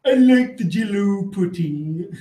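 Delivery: slow, lightly voiced Cosby line; Bill Cosby impression. Repeating the chat's bit, a light slow Cosby voice. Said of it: "Bill Cosby impression".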